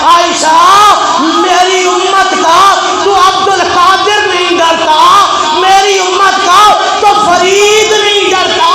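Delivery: the Prophet reassuring Aisha, shouted; preaching shout. Acted. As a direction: declare fearlessness at full force.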